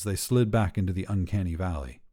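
ordinary delivery

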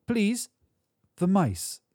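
very high little chirp; high-voiced, hard-edged woman. A narrator's reading. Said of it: "Her words were hard-edged chirps".

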